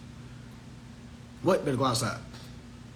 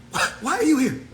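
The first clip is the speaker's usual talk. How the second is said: laughing; 9 semitones higher than usual. Real, not acted. Repeated taunt, laughing.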